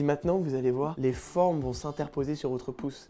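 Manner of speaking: hushed hypnotist guiding the patient; soft mock hypnotist. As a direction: lull him into the trance. Hypnotist instructions.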